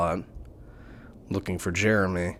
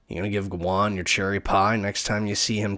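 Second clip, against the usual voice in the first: ordinary talk, nothing marked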